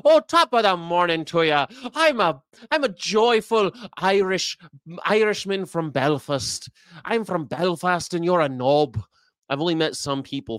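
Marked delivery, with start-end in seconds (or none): 0.0-9.0 high, lilting put-on Irish accent. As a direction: play a joyful Irishman, hammed-up accent. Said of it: "I only got one Irish accent".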